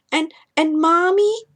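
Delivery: high, small, questioning call; a little boy calling out in the dark; tiny child's voice. Acted. Ethan calls his mother at night, high and tiny.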